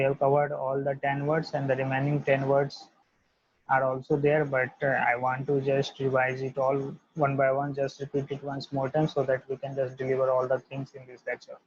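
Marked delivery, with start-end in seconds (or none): none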